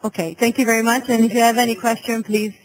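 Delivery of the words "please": ordinary talk, nothing marked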